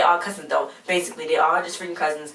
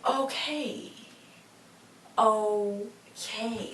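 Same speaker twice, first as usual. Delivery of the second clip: higher, drawn-out okay; sceptical okay; 4 semitones higher, 4 dB quieter, much slower than usual. Retold or imitated. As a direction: stretch out a doubtful okay. Her sceptical reaction to the story.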